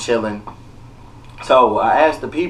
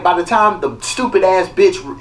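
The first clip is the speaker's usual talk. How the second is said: ordinary talk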